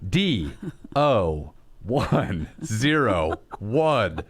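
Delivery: spelled out slowly, low and deliberate; slow dictation. Shown as a demonstration. Host reading a promo code to listeners, slow and deliberate. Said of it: "get your pens out".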